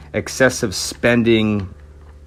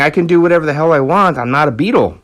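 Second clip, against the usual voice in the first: fast, mildly heated quote, a bit louder; pissed-off retort; 4 semitones higher, 3 dB louder, much faster than usual. Retold or imitated. Jimmy retorting to the minders, mildly pissed off. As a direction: rattle it off with a touch of irritation. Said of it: "Jimmy's pissed off".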